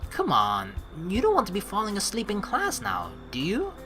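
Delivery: ordinary delivery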